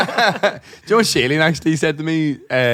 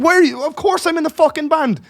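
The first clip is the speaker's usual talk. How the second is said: high, loud retold outburst; retold aggressive snap; 14 semitones higher, 5 dB louder than usual. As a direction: fire it back at him, much higher and louder. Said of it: "I was probably a bit aggressive".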